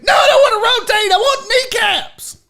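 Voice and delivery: loud, high, fast hammed-up yell; crazed coach refusing at full volume; mock furious shout. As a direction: scream the demand in pretend rage. Acted coach refuses teammate's game request.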